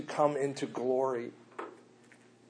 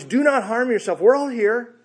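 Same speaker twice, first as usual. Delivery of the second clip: loud, high pleading call; Paul calling out loud from the cell; loud cry; 9 semitones higher, 8 dB louder than usual. Acted. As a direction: shout to reassure the jailer. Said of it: "cries out in a loud voice".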